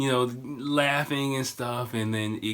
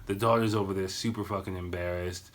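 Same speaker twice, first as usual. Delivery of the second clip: ordinary talk, nothing marked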